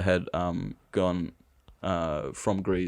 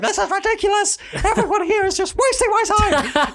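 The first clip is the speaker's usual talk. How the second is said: much higher, louder shrieking teacher; imitating furious teacher; 4 dB louder than usual. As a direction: mock-scream at the whole class. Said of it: "screaming at everyone".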